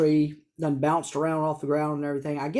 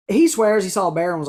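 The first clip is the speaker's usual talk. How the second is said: normal talking voice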